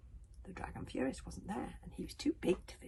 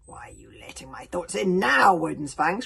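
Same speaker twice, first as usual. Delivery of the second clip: loud raging dragon yell; furious dragon shouting; dragon scream; 2 semitones lower, 18 dB louder than usual. Acted. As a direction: scream at the old sea dragon in fury. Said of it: "screamed the Dragon Furious".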